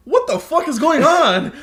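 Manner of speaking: high, loud, slow baffled cry; walking in on parents' spa day, mock-outraged; shocked disbelief. Retelling own shocked reaction.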